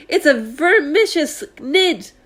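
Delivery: high, slow, loud dread; horrified police chief. Acted. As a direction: draw out the horror.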